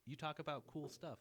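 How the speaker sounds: imitated fed-up listener; mock exasperated outburst. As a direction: cut him off, playfully fed up. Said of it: "Yeah, I'm like".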